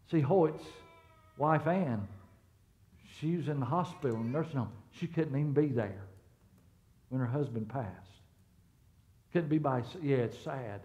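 low, soft, subdued sadness; somber. Real, not acted. Pastor telling church of a widow's loss, somber. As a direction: speak gently and low, weighed down by a loss.

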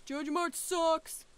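loud, slow, high yell; drive-by shout. Pretend. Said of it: "a drive-by shouting at Joja Mart".

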